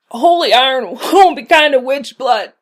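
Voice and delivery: loud, pained gasping laugh. A narrator's reading. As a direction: force it out through pain with a laugh. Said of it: "he gasped".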